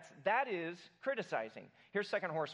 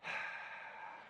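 long exasperated sigh; breathy huffing sigh; second huff; 8 dB quieter than usual. Shown as a demonstration. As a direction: let out another heavy annoyed sigh. Huffer sighing again.

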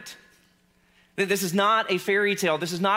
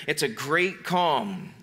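slow, calm; 4 semitones lower, much slower than usual. Shown as a demonstration. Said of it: "slow emphasis".

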